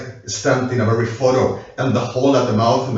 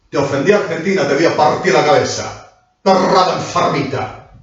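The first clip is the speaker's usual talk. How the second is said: raised, louder furious outburst; angry threat; 8 semitones higher, 8 dB louder than usual. Real, not acted. Streamer lashing out at Argentina, angry raised voice. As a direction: hurl the threat at the whole country.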